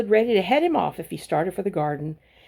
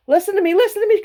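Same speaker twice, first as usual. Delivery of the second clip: much higher, loud squawking goose; goose crying out; 13 semitones higher, 7 dB louder, much faster than usual. A narrator's reading. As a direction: squawk for attention like a frantic goose. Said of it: "cried the goose".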